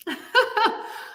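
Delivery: a high, rising, real laugh; amused laugh. Real, not acted. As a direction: let a laugh slip out. Laughs after prize list.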